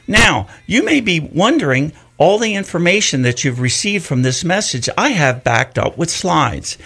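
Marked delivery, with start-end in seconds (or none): none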